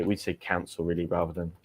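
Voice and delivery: normal talking voice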